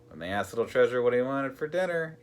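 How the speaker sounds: usual speaking voice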